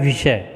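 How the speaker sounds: विषय as "vishay"; v and sh sounded in विषय; correct pronunciation. Shown as a demonstration. Teacher corrects the common error, विषय with v and sh. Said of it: "जबकि होता है".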